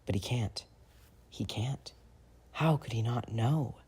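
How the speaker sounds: hushed unvoiced whisper. A narrator's reading. Narrating James's frozen despair in a whisper. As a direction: let it fall to a bare whisper.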